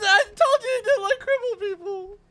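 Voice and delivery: panicked. Real, not acted. Panicked in game.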